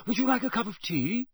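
eager, slightly breathless politeness. A narrator's reading. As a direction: offer tea to someone you idolise. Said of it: "said Percy breathlessly".

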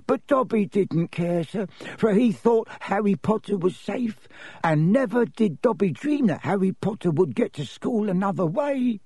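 high, squeaky little elf voice; high-voiced house elf explaining himself; Dobby the elf, earnest. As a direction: confess it in a high-pitched servile voice.

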